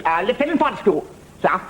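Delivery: high-pitched junior officer caricature; hammed-up young British officer; young Sandhurst gentleman. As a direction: report to the CO as an eager new lieutenant. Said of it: "a young gentleman just finished in Sandhurst".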